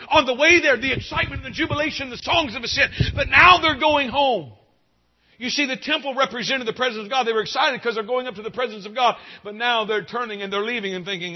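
high, loud preaching, slowing on 'But now'; full-force preaching. Acted. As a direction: ride the excitement, then lean on the turn.

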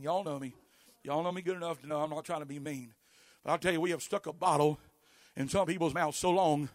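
ordinary talk, nothing marked

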